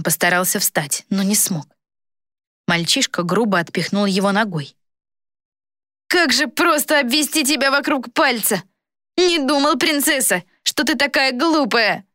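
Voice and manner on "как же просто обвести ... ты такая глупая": put-on high-pitched taunting boy villain; mocking boy, changed voice. A narrator's reading. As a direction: taunt her in a boy's high voice. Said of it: "Рассмеялся парнишка не своим голосом".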